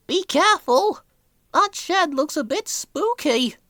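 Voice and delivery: put-on high, young engine voice, a bit worried; a small tank engine warning his driver; nervous little engine voice; Thomas. A narrator's reading. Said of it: "said Thomas".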